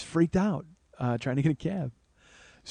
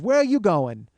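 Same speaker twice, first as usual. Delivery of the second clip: high, loud nosy demand; pushy woman; 5 semitones higher, 5 dB louder than usual. Retold or imitated. Woman in the cab line to comedian, pushy. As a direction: imitate a brash New Yorker butting in.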